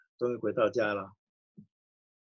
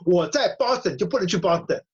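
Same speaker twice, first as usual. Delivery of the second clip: raised, insistent reply; 我在Boston louder, falling hard; indignant protest; 5 semitones higher, 5 dB louder than usual. Retold or imitated. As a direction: push back hard on Boston. Daughter protests in retold quarrel.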